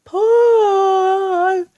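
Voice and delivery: high, loud drawn-out yell. A narrator's reading. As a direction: bellow the command. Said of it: "yelled Mugglewump".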